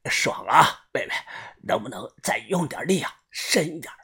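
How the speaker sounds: 气息不稳，低声挑逗; 喘息着轻声说; 喘着气笑说. A narrator's reading. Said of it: "大口大口的喘着气". (气喘吁吁地轻声调笑)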